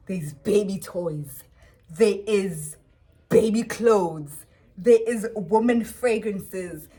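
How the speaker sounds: slow, loud, each item stressed in disbelief; slow emphatic listing. Real, not acted. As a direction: spell out each item, stunned. Listing the girlfriend's things, incredulous.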